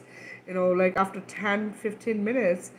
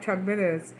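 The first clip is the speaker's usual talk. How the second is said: normal talking voice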